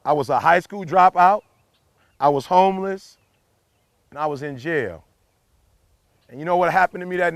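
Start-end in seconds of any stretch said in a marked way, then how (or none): 0.1-5.0 slow it down, with a pause before each phrase; said slower. Shown as a demonstration. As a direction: let every fact land, one by one. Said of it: "I'ma say it slower".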